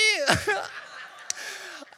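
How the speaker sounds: high, breathless laugh; burst of laughter. Real, not acted. Dad at his daughter's joke, high breathless laughter. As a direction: crack up helplessly.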